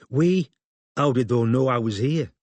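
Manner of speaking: a lightly voiced bristling northern woman; suspicious Holly. A narrator's reading. Holly to Robin, mistrustful and bristling. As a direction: push back with suspicion.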